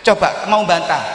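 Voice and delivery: normal talking voice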